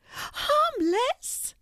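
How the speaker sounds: incredulous high squeak, rising at the end; tiny mouse, very high pitched; exclaiming mouse. A narrator's reading. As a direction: squeak it back in disbelief. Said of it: "exclaimed the mouse".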